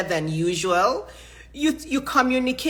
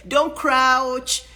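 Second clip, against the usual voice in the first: ordinary talk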